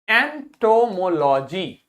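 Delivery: एंटोमोलॉजी slowly, syllable by syllable; pronunciation demo. Shown as a demonstration. Teacher showing pupils how to read एंटोमोलॉजी, slow pronunciation demo. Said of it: "pronunciation सीख लेते हैं".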